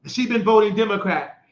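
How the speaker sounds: ordinary delivery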